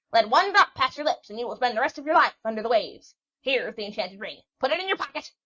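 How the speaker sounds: loud, high, scolding crone; an old witch scolding the young sailor; cranky old witch. Acted. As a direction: rattle on like a fussy old hag.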